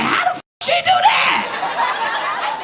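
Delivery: high, slow, shrieking disbelief; stunned audience woman. Retold or imitated. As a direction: squeal it out like a shocked woman in the back. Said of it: "bitches in the back was like".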